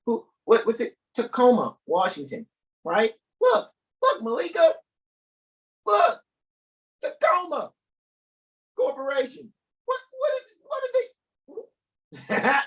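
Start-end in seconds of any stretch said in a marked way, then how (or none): 3.4-12.6 very high squealing disbelief; mock outrage. Host squealing in mock outrage to co-host Malika.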